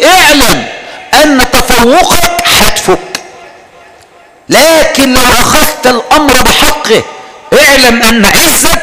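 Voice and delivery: slow, weighty preacher's warning; preaching. Acted. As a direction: lean on each word like a sermon. (وعظ بطيء ورزين)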